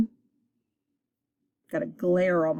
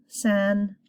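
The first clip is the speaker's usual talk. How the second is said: ordinary delivery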